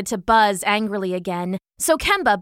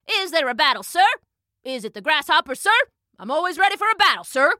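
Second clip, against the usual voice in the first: higher, crisp military little voice; eager soldier ant; 8 semitones higher, much faster than usual. A narrator's reading. Ant soldier asking Kemba about battle, eager and crisp. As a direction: fire off questions, punching each "sir".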